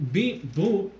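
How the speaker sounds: lightly voiced robot Walken; a hint of robotic Walken drawl; robot Christopher Walken. Shown as a demonstration. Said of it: "Christopher Walken would sound like as a robot".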